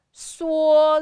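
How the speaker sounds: SUO stretched on a high, level pitch; first tone. Shown as a demonstration. Teacher modelling the third line for learners, high flat suo. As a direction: hold suo high without bending it. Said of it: "Suo is also the first one".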